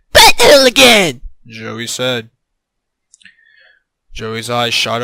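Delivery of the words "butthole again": loud, high cry of dismay; dismayed yell. A narrator's reading. Dismayed realization, acted outcry.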